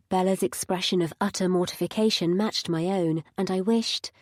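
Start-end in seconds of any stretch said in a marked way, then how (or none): none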